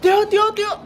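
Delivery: high, loud greeting shout; loud call to friends. Retold or imitated. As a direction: yell out to your friends. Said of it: "when I see my friends".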